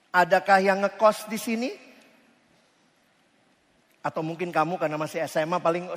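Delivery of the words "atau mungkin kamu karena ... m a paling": ordinary talk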